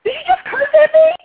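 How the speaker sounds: very high, loud fake offense; mock outraged shriek. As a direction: shriek it like you're scandalized. Mock outrage over a question.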